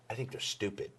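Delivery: very soft, retold contempt; hushed mock disdain; imitating dismissive manager. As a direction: say it under your breath as a disdainful aside. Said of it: "but they can tell the tone".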